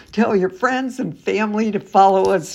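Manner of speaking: normal talking voice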